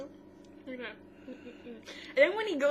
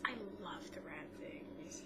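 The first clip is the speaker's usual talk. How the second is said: hushed whisper; 4 semitones lower, 13 dB quieter than usual. Retold or imitated. Ten voiced whispering in retelling.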